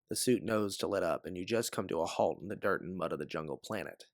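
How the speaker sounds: ordinary delivery, nothing marked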